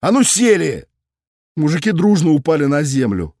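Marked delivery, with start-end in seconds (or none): 0.0-0.9 barked. A narrator's reading. Cop barks at collared drunks, angry order. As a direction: snap them down to the ground. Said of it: "встряхнул и рявкнул".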